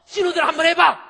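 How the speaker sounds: very high, very loud scream; vengeful shout. Retold or imitated. Daughter-in-law daring sisters-in-law to do it, furious scream. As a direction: hurl it screaming at the in-laws. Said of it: "이렇게 소리 지를 일은 아닌데".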